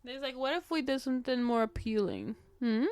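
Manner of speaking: ordinary talk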